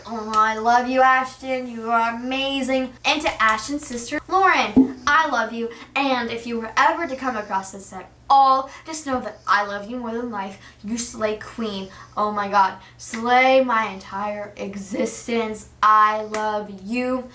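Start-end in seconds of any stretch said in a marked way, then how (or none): none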